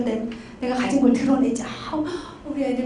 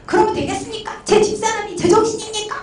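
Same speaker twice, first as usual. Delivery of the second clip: mock angry yelling; 9 semitones higher than usual. Big pastor client complaining to counselor about his wife, mock yelling. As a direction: imitate an irate client protesting. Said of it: "막 소리 지르면서".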